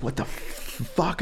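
high, breathless shocked whisper; shocked hushed gasp. Retold or imitated. Reliving the trip, he hisses at her in shock. Shoving her away in panic.

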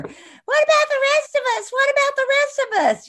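high, loud mock outrage; mock outraged. Actress mocking the other passengers, high outraged whine. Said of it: "and I thought".

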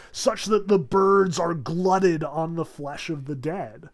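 usual speaking voice

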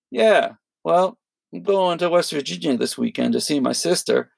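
a hint of an oblivious stranger rambling on; imitating chatty doorman. Retelling Tom Segura's bank guy, lightly imitating his chatty overshare.

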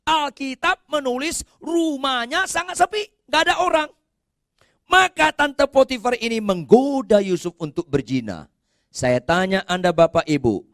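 loud, high-pitched pulpit proclamation; full-force preaching. Real, not acted. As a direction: proclaim the Bible story loudly, pitch gradually falling.

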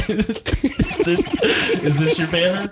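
laughing stage magician asking is this your banner; magician reveal. Pretend. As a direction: present it like a card trick reveal. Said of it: "Like it's a magic trick".

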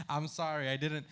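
usual speaking voice

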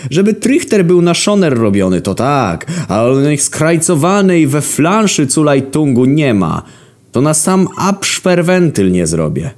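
high scornful tradesman; mocking. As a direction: scoff at the customer's ignorance. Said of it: "Uśmiechnął się drwiąco".

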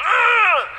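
hammed-up high baby grunt. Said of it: "grunting at me and going".

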